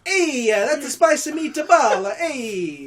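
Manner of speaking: overplayed spicy meatball Italian; mock Italian accent. Joking friend calls it Southern, mock Italian. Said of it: "here's my Southern accent".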